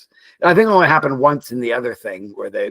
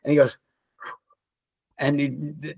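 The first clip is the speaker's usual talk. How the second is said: ordinary talk, nothing marked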